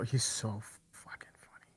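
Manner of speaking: breathless whisper of admiration; whispered gush. Real, not acted. Fan gushing about Laszlo to friend, whispered. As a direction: hush it, overwhelmed.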